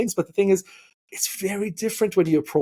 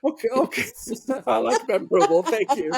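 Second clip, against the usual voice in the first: sheepish laughing; 7 semitones higher than usual. Retold or imitated. Caught, nervously agreeing with officer.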